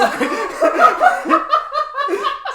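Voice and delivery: loud high laugh burst; helpless loud laughter. Real, not acted. Big laugh.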